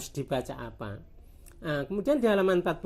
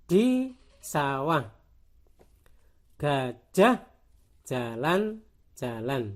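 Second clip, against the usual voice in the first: slow, separated syllables; deliberate beginner-reader pacing; syllable-by-syllable reading; much slower than usual. Shown as a demonstration. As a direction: read like a primer, one syllable at a time. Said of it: "halaman berikutnya lebih menarik".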